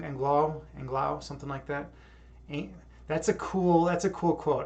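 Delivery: ordinary talk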